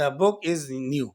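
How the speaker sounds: slow demo, "The" said short as "da" before the consonant of book. Shown as a demonstration. Teacher slowly models "the" before a consonant sound. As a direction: read "The book is new" slowly, with "The" as "da". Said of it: "تو اس میں ہم دا پڑھیں گے".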